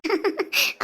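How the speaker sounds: low-key chuckle; soft laugh. Acted. Laughs at family teasing. (மெல்லிய சிரிப்பு)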